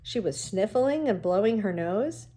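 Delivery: ordinary delivery, nothing marked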